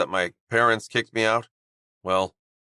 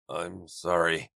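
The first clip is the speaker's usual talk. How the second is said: raspy; 5 semitones lower, 5 dB quieter, slower than usual. Acted. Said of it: "Roman rasped".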